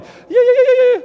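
high, loud whooping yeahs; victory cheer. Retold or imitated. Israelites celebrating after the Red Sea, whooping cheer. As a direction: cheer like you just crossed the sea dry. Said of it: "You'd be going".

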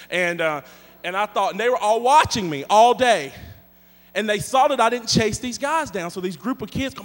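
ordinary delivery

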